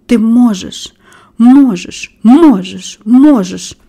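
"можеш" hit loudly each time, separated by small pauses; urgent repetition. A narrator's reading. Girl willing the boy to stand, urgent repeated "можеш". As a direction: repeat "можеш" like an insistent chant, punching each one. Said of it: "повторювала вона".

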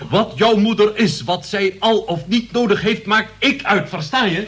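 angry shout. Acted. Uncle shouts at his nephew over the mother, furious. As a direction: lay down the law, shouting. Uncle threatens sister's son.

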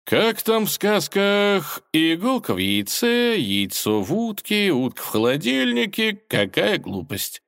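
mock the fairy tale, sneering; mocking villain. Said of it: "Вздохнул он". (насмешливо, нараспев)

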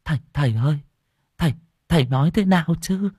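put-on anxious old woman.

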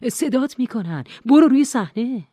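urgent, high and loud, building to the last words; almost yell it, pushing harder at the end; near shout. A narrator's reading. Brother urging Nora onto the stage, almost shouting.

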